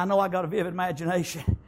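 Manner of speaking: usual speaking voice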